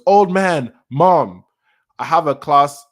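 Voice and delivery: lightly acted calling out; imitated call to parents. Son calling back to his parents, mildly acted loud call.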